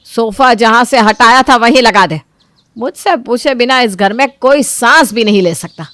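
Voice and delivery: loud, bossy mother-in-law; domineering scolding. Acted. Mother-in-law asserting her rule over the house, domineering. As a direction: lay down the law.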